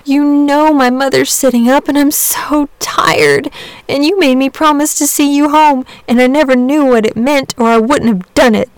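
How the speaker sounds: put-on high, tired child's complaint; a worn-out boy near tears; pleading boy. A narrator's reading. Boy complaining to sleeping dragon, high tired pleading.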